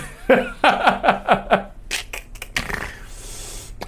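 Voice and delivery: breathy unvoiced laugh; silent laugh. Real, not acted.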